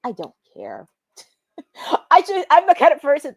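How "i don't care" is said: low, soft dismissive deadpan; unimpressed, under-the-breath shrug; sarcastic indifference. Real, not acted. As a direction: drop it low and quiet, couldn't care less. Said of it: "I'm being sarcastic in a way".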